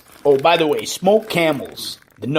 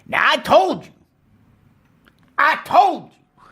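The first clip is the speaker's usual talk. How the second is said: much higher, pleading I told you; high-pitched insistence; 14 semitones higher, 4 dB louder than usual. Acted. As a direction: squeak it out, exasperated.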